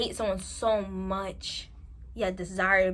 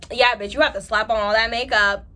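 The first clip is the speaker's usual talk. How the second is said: louder, snide jab; mean friend; 9 dB louder than usual. Retold or imitated. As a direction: throw the insult like a mean friend.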